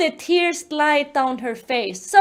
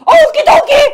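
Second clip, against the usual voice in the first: very loud, squeaky-high chirp; cartoonishly eager agreement; 11 semitones higher, 12 dB louder than usual. Officer agreeing to Olivia's request, cartoonishly eager. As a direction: chirp it back with absurd enthusiasm.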